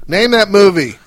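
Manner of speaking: high loud game-show call. Real, not acted. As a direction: belt it like a quizmaster. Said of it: "quiz call to co-host".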